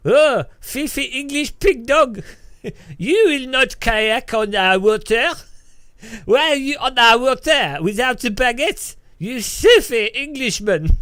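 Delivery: high, loud mock furious French policeman; mock French cop chasing the Englishman off; angry French cop. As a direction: yell it like an outraged gendarme.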